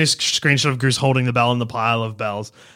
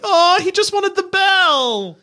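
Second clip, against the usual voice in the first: much higher, louder and slow; high drawn-out voice; 5 dB louder, slower than usual. Retold or imitated. Quoting viewers' realization.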